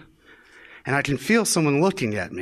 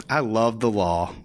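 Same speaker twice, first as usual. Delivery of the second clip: deadpan; 7 semitones lower than usual. Shown as a demonstration. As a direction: mutter "I love the law" flatly to yourself. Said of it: "You know what?".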